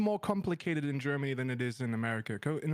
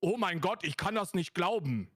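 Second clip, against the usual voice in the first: mock-angry German accent demo; angry German accent, as a German would say it; harder German accent. Shown as a demonstration. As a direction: bark it in a harsh German accent. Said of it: "This is like a German one".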